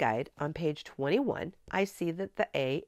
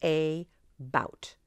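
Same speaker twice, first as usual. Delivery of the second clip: "A bout" as AY... BOUT, both syllables stressed alike; even weight on each half of "A bout"; equal stress. Shown as a demonstration. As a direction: separate "A bout" with a full long A. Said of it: "the same amount of stress".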